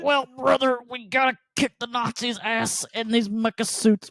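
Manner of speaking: high, theatrical hello brother; character voice. Retold or imitated. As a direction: ham it up like a costumed hero. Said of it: "He's like".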